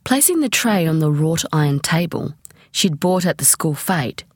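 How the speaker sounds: plain reading voice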